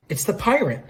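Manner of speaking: high-pitched sudden exclamation; excited realization. Acted. Jill spotting the pirate, high excited blurt. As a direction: blurt it out in alarmed recognition.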